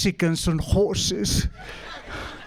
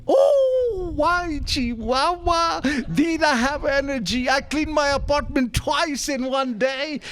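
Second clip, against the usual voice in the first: much higher, drawn-out exclamation; a stretched high excited cry; wired excitement; 7 semitones higher, slower than usual. Retold or imitated. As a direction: burst out hyped up on pills. Recalling drug-fuelled energy rush.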